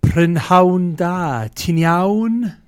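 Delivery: high, very loud, drawn-out hello; booming Welsh greeting. Pretend. Co-host saying good evening in Welsh, loud showy call.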